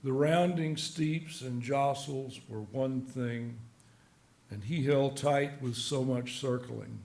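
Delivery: slow, measured poem recital; soft, deliberate verse reading. Acted. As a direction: read the poem evenly, pausing at each phrase. Reads his poem aloud.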